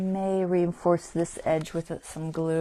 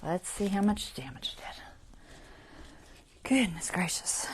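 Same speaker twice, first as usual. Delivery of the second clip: whispered while inspecting; hushed, close to the work; 3 dB quieter than usual. Real, not acted. Checking the damage up close.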